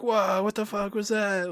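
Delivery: high, slow startled gasp; recalling a stunned first reaction; shocked. Retold or imitated. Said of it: "I remember being like".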